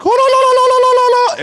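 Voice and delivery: shrill mock squeal of a high Irish voice, way up high and loud; sing-song squealed la la la; Irish turkey voice. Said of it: "It's this Irish turkey".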